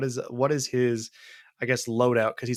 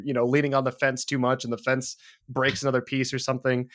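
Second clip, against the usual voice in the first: usual speaking voice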